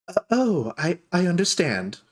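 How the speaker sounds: high, stammered acknowledgement; taken aback. Acted. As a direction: stumble over it, caught off guard. Shopkeeper taken aback by news.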